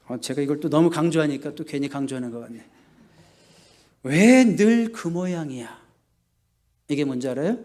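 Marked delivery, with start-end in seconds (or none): 4.0-5.8 contemptuous scolding. Acted. Husband scolding his wife.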